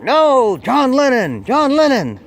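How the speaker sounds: yelling back. Retold or imitated. [name] yelling back at the kid, high loud insistence. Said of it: "And you'd yell back".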